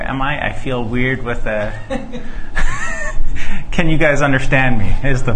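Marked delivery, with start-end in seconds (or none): 1.8-3.7 breathy high nervous laugh. Real, not acted.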